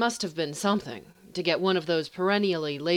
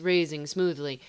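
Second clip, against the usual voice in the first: usual speaking voice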